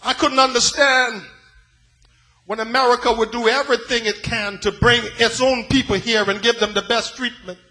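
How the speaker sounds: normal talking voice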